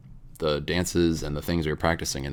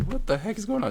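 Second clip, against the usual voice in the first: bewildered; 4 dB quieter, faster than usual. Retold or imitated. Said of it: "we're like".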